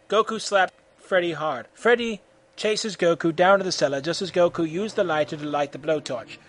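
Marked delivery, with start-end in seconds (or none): none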